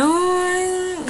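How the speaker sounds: high, drawn-out imitation wail; breathless cry. Robbed victim unable to breathe, imitated breathless wail. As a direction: mimic crying with no breath to speak. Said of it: "I couldn't even breathe".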